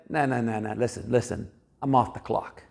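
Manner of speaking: low, lightly voiced brush-off; dismissive refusal. A hint of Jesus refusing the woman, low and dismissive. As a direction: wave them off with a flat no.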